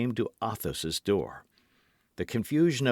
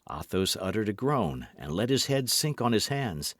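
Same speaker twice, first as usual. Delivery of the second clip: ordinary delivery, nothing marked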